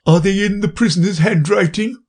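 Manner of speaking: high timid juror. Acted. Juryman asks.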